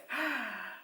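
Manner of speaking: big audible gasp in; sharp, high intake of breath; deep breath. A narrator's reading. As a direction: draw a deep breath before asking. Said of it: "took a deep breath".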